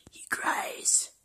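soft, voiceless mock sorrow; hushed little lament; whispered pity. Real, not acted. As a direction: whisper it pityingly about the dog.

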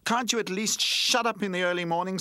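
high, irritated complaint; emphatic. A narrator's reading. Toy woken by the noisy newcomer, emphatic complaint. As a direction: scold in a high, insistent voice. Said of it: "said Roller emphatically".

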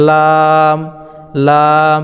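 লাম with tongue tip on upper gums, held one alif; Arabic letter lam. Shown as a demonstration. Teacher demonstrating লাম to students, drawn out. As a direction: chant লাম with a long pull. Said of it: "এক আলিফ পরিমাণ টান দেবেন".

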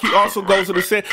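ordinary delivery

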